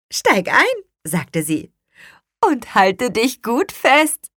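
a lightly voiced cheerful witch; high, slow friendly order. A narrator's reading. Hexe zum Känguru. (hohe, freundliche Hexenstimme)

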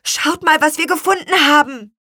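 put-on high, loud children's shout; eager high-pitched announcement; called out. A narrator's reading. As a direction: call everyone over excitedly. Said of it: "riefen Lea und Luis".

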